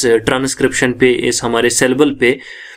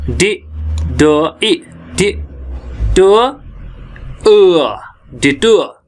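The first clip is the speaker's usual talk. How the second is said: syllable by syllable; 3 semitones higher, much slower than usual. Shown as a demonstration. Teacher shows how the word splits, slow isolated sounds. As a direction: sound out "डि" and "टो" slowly, sound by sound. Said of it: "देखिए कैसे".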